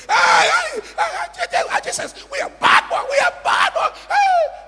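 high frantic shouting of hey; shrill-pitched frantic calls; parents calling out. Acted. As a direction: call out to a lost child in panic. Parents calling out to boy Jesus.

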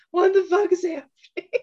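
acted disbelief, pitched high; mock bewilderment. Host reacting to the mismatched episodes, mock bewilderment.

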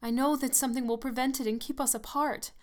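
high, loud and distressed; sudden distress. A narrator's reading. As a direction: burst out with desperate foreboding. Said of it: "Evelyn cried in sudden distress".

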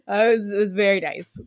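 laughing through the words. Real, not acted. Co-host laughing at her own dinner story, real laughter. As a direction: giggle it out before settling.

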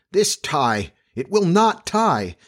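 understated storybook tantrum over a tie; yelling. A narrator's reading. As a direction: pitch leaps up, words drawn out in frustration. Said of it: "He really yelled".

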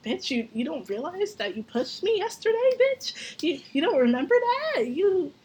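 indignant retort. Retold or imitated. Retelling how she confronted the guy who pushed her, indignant and incredulous. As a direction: pitch climbing high on the second bitch, incredulous. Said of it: "I was like".

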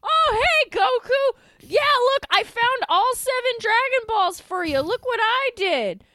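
high, loud, eager showing off; eager Krillin. Retold or imitated. Podcaster mocking Krillin showing Ginyu the Dragon Balls, high and eager. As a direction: beam it like a proud little kid. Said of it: "he literally is like".

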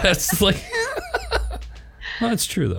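high, laughing through words; giggling while he talks. Real, not acted. Laughing after being teased.

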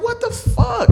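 high loud shocked exclamation. Retold or imitated. Watching the magic trick, shocked high exclamation. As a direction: squeal it in disbelief. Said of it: "I'm like".

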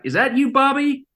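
high, lightly voiced older singer calling out. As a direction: pitch it up and call toward the door. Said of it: "[name] is like".

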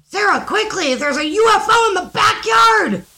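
high-pitched, urgent shout. Acted. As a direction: shout it as a mom rousing her kid. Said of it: "As she's shouting at me".

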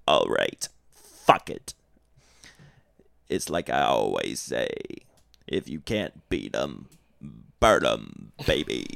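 very low, slow menacing growl; gloating demon voice; villain djinn. Retold or imitated. Djinn gloating as he burns the painting, very low. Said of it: "the djinn says".

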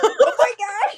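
laughing exclamation. Retold or imitated. Retelling the bathroom moment to her friend, a high laughing exclamation. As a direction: blurt it out cracking up. Reacting to coworker's remark.

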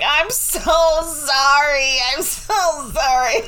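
high, drawn-out mock crying; imitated tearful apology. Parent retelling a tearful apology to child, mock weeping. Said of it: "I broke down into tears".

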